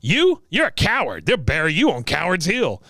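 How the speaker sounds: high contemptuous veteran impression; mock old soldier hurling an insult; Cotton. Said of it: "There it comes again".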